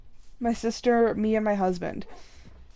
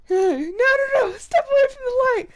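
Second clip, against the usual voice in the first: higher, louder mock wailing shout; Bug's Life quote; 13 semitones higher, 5 dB louder than usual. Quoting Bug's Life to the pig, mock wailing shout. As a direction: wail it like a frantic cartoon bug. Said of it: "on Bug's Life".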